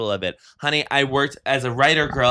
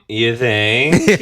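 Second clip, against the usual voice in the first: sarcastic "you think"; 7 dB louder than usual. Retold or imitated. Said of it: "in a nasally voice once".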